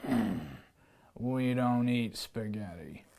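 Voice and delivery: low lion voice; a low lion turning down spaghetti; growled lion. Acted. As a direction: answer low like a gruff lion. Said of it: "The lion growled".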